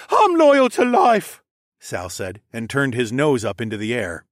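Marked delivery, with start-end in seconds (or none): 0.1-1.4 high, loud, slow whine; indignant whiny prisoner; sniveling captive. A narrator's reading. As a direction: protest in a pitched-up coward's voice. Sniveling captive answers the insult.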